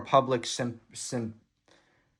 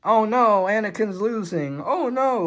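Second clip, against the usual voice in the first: high-pitched pretend worry; hammed-up, high "uh-oh" voice; mock alarm; 8 semitones higher than usual. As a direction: play up the dismay at a losing racer. Voices alarm at race image.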